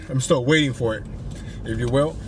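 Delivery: usual speaking voice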